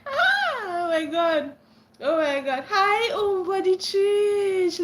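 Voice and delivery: high, slow delighted squeal; excited greeting. Real, not acted. Greeting a friend not seen in a long time, delighted. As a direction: stretch the hi with joy.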